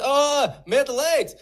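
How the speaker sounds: loud, high mock realisation; exaggerated "oh, now I get it"; sudden recognition. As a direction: imitate a server finally catching on. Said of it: "So, and they say".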